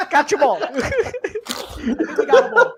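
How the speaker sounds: high, giggling punchline; laughing. Real, not acted. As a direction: laugh through the joke as you land it. Joking about catching all the racism.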